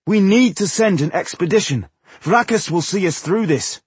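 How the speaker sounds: high, impassioned plea to a crowd; begging. A narrator's reading. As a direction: urge them with all your conviction. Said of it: "he begged the assembly".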